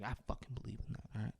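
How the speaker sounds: quiet fast hiss. Retold or imitated. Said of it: "Tim Allen's like".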